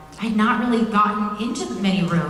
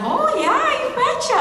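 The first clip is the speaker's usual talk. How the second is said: high, bright Upper Midwest "you betcha"; chirpy Minnesota accent, pitched way up. Retold or imitated. Actress showing the director her Minnesota accent. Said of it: "her to have an accent".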